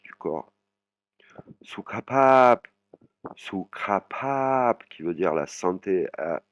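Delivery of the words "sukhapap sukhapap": "Sukhapap" slow and high, carefully shaped; "Sukhapap" said slowly; Thai pronunciation. Shown as a demonstration. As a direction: spell out "Sukhapap" for beginners. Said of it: "très dur à prononcer".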